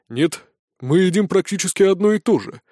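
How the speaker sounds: plain reading voice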